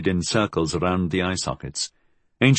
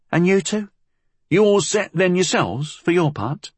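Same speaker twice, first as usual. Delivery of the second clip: put-on higher, challenging young woman; cheeky girl; 9 semitones higher than usual. A narrator's reading. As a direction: push back with a question. Molly questions the men.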